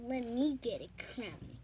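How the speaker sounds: ordinary talk, nothing marked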